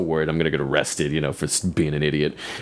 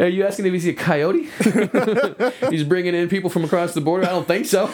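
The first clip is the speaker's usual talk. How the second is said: laughing; 12 semitones higher than usual. Real, not acted. Laughing mock reply to cop.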